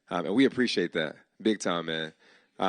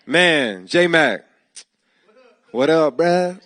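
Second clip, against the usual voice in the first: loud and higher, hyped shout-out to a friend; excited greeting; 7 semitones higher, 12 dB louder, much slower than usual. Real, not acted. Host hailing his friend J-Mac from the stage, loud hype.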